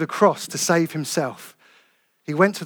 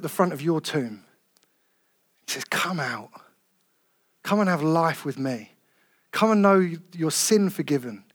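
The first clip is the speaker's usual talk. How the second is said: soft, low, tender invitation; 4 semitones lower, 6 dB quieter than usual. Real, not acted. Preacher gently inviting visitors to come to God, softly. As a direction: speak gently as if inviting someone home. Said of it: "with all tenderness in my heart".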